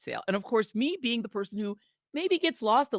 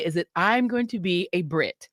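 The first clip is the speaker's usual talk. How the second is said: ordinary talk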